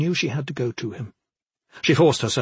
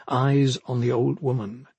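ordinary delivery, nothing marked

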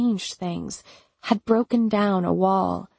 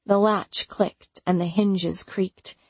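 ordinary delivery

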